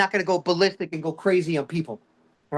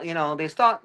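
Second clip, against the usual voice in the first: normal talking voice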